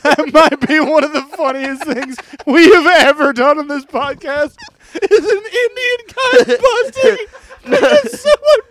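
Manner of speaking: high-pitched, near-tearful laughter through the words; laughing hard. Real, not acted. Podcaster hails the funniest bit ever, laughing helplessly. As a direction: struggle to talk while cracking up.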